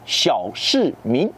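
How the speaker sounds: Xiao Shi Min said slowly in Mandarin with its tones; Mandarin term. Shown as a demonstration. Teacher giving French viewers the Chinese term, slow Mandarin. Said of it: "des petits bourgeois urbains".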